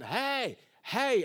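drawn-out hollered calls; retold screaming. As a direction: holler like a man chasing a bus. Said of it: "A man starts screaming".

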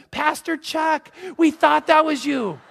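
high, drawn-out gushing hello; imitating an overjoyed churchgoer; delighted greeting. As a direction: sing out a thrilled recognition, slowly. Other driver greets pastor, delighted.

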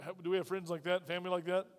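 ordinary delivery

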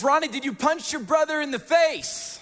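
high-pitched mock mom interrogation; imitated scolding mom. Mom questions son about punching his brother, imitated scolding. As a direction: lightly play a mother demanding answers.

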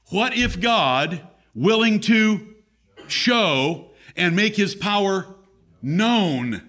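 high, emphatic pulpit delivery. Real, not acted. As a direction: declaim it in weighty, broken phrases. Preaches God's coming glory.

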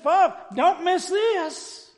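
high, drawn-out preacher's yell; loud, slow, urgent warning from the pulpit; pulpit-pounding shout. Acted. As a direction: cry it out to the whole crowd. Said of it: "it's like he hit the pulpit".

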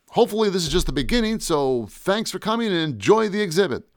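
high-pitched host wrapping up; announcer voice. Acted. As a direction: send the crowd off like an announcer. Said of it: "In my best announcer voice".

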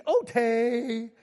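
put-on high little-kid "okay" with a laugh; toddler voice. Grandpa imitating his grandson saying okay, laughing. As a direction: squeak it like a toddler who can't say K. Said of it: "he can't say a K yet".

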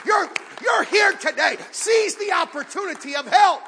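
shouted, high sermon climax; preaching at full force. Acted. Pastor urging the congregation to seize help, shouting preacher. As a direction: shout the call to the whole room.

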